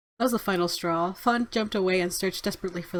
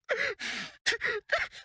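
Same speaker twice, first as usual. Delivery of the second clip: breathless rising titter; high rising giggle. Acted. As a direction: giggle climbing higher. Laughing at the sleepers.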